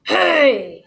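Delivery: long, loud, high "hey"; loudest, scariest voice. A narrator's reading. Little Tim Tim scares the alligator, shouting. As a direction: bellow to frighten a foe. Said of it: "in his loudest and scariest voice".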